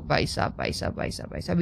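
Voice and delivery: soft, low repeated mutter; nervous muttering. Real, not acted. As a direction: chant it under your breath, uneasy. Uneasy exploring in horror game. (दबी आवाज़ में घबराई बुदबुदाहट)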